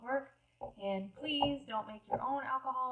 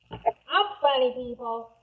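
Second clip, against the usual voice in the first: proud announcement; 3 semitones higher, 12 dB louder, slower than usual. Pretend. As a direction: declare it loudly to the audience. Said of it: "See what I did there?".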